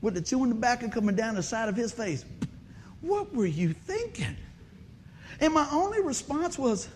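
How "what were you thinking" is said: slow, higher disbelieving scold; dad scolding. Retold or imitated. Lightly voiced father asking his son what he was thinking, slow scold. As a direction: scold like a dad who can't believe it. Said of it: "I can hear my dad say this".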